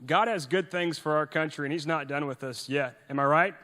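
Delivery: normal talking voice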